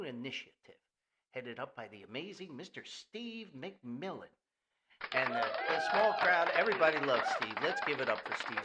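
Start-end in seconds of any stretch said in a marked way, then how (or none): 5.1-8.6 loud emcee shout-out; crowd hype. Acted. Host hyping a sponsor to an imaginary crowd, loud emcee style. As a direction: pump up the crowd for Steve.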